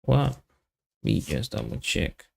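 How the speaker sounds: ordinary talk, nothing marked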